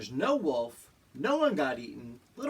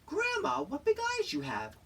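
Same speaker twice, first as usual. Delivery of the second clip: put-on much higher sweet little-girl voice; 13 semitones higher than usual. Red repeating the line to the disguised Grandma, high and girlish. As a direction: play the innocent little girl. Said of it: "Good job, Red".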